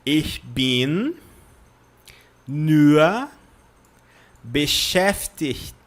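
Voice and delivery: each word drawn out and separated; slow, word-by-word demonstration; slow repeat-after-me. Shown as a demonstration. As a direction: say it slowly for learners to copy. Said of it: "Repitam comigo lentamente agora".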